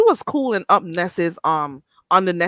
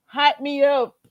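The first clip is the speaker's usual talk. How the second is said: cracking herself up; 6 semitones higher, 7 dB louder than usual. Real, not acted. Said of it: "Why do I crack my own darn self up".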